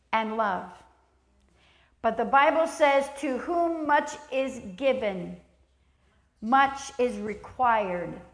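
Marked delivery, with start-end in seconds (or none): 3.2-8.2 much said slowly and weighted; solemn slow quote of the verse; slow scripture emphasis. Acted. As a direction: lean on each much.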